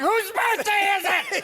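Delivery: put-on high, loud, slow drunken party yell; drunk partier. Imitating a drunk party guy, loud, high and slow. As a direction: bellow like the naked guy on the table. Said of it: "naked on the table going".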